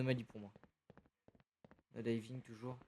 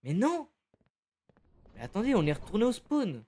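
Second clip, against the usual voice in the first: high loud exasperated cry; frustrated outburst; 7 semitones higher, 6 dB louder than usual. Real, not acted. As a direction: yell at the game in disbelief.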